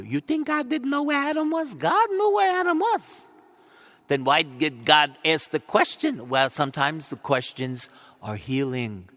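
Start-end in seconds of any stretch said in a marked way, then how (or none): 0.0-6.3 loud, high preaching challenge; fiery pulpit voice; full-force preaching. Acted. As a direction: drive the question home to the congregation.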